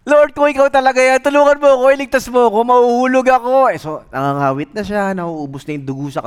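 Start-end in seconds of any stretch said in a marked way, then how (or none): 0.1-3.7 high, loud begging; frantic plea to God; desperate pleading. Retold or imitated. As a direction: plead for rescue. Jogger begs for rescue.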